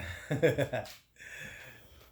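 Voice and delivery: laugh. Real, not acted. Laughing about the bathroom milestone.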